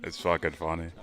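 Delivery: normal talking voice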